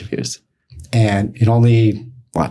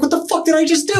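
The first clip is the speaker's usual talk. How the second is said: much higher-pitched alarmed yelp; mock panic; faster than usual. Storyteller imagining himself being pulled over, mock panic. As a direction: act out a comic panicked reaction.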